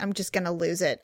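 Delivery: holding it in; restrained threat. Retold or imitated. Retelling her threat, first take.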